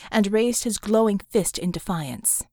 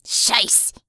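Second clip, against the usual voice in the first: swearing. A narrator's reading. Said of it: "Doppelganger swore".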